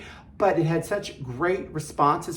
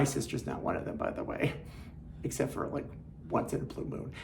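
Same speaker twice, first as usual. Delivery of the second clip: softer, whispered teasing aside; 4 semitones lower, 13 dB quieter, faster than usual. Real, not acted. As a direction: lean in and whisper the jab. Teasing aside about her sister.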